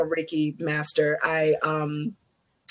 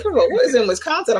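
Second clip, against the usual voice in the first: incredulous; 7 semitones higher, faster than usual. Retold or imitated. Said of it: "I'm like".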